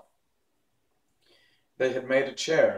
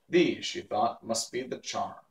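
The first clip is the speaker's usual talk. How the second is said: usual speaking voice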